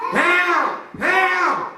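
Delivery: high, loud cry for help; mama crying for help. Acted. Mama screaming from the car window. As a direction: shout it like a panicked mother. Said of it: "you know what she said".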